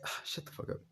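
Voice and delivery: breathy hushed brush-off; whispered comeback. Retold or imitated. Girl shutting the boy up, whispering. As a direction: whisper it, embarrassed. Girl snapping back at teasing.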